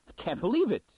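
insist, voice jumping up; sudden conviction. A narrator's reading. Munn refuses to believe his test, loud and high. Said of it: "with sudden conviction".

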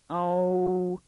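put-on high, drawn-out girlish "oh"; mortified groan. Daughter betrayed before her father, in mortified dismay. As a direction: hold one high note of dismay.

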